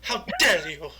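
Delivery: mock Greta outrage; shaking with anger. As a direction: tremble with fake fury. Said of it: "you gotta get the tremble".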